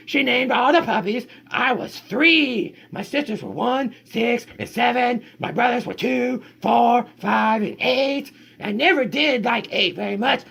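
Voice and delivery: high, lively storybook read; reading to children. Acted. Parent reading the dog's story to kids, high and lively. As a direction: tell the puppy's tale brightly to the kids.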